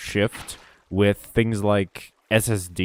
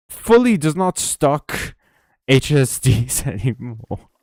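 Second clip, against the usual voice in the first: mock outrage; 5 semitones higher, 4 dB louder, slower than usual. Real, not acted.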